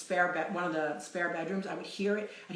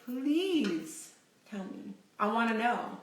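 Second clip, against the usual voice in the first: drawn-out, high-pitched plea; pleading; 4 semitones higher, slower than usual. Real, not acted. Streamer begs viewers for their spooky stories, pleading. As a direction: beg them, stretching out the please.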